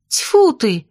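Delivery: high, falling exasperated outburst. A narrator's reading. Lyolya fed up with the digressions, exasperated. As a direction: burst out, losing patience. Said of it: "не выдержала Лёлька".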